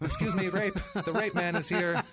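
high, laughing creep voice; sing-song like an ice cream truck; laughing mock creep. Friends joking the attacker is an ice cream man, high and laughing.

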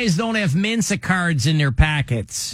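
broad Chicago cop impression; comic Chicago-accented lecture. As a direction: imitate a Chicago cop sizing up crooks. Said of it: "that's the Chicago accent".

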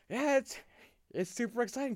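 high-pitched mock kid excitement; gushing little-kid energy, imitated; imitating excited kid. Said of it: "all the little kid energy".

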